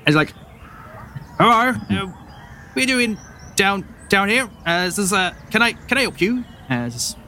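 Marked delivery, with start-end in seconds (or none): 1.4-6.4 high-pitched wary farm wife; a suspicious farmer's wife questioning strangers; farmer woman. Acted.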